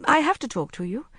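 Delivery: insist, stressing the need; urgent plea to the older woman. A narrator's reading. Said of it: "striding toward her across the lawn".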